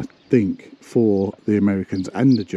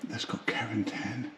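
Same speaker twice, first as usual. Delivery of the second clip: hushed unvoiced whisper, much softer; 3 semitones higher, 12 dB quieter than usual. Real, not acted. Exploring the church with Andy, whispering. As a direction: keep your voice down in the church. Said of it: "Why am I whispering?".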